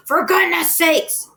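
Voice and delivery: soaked farmer bellowing at the hired man. A narrator's reading. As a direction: roar it out drenched. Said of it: "bellowed Mister Zuckerman".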